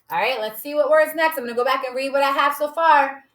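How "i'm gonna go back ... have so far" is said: ordinary delivery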